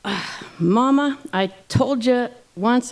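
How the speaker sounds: long-suffering husband starting a lecture; exasperated Papa Bear. A narrator's reading. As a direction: drag it out like a fed-up father bear. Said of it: "Papa Bear said".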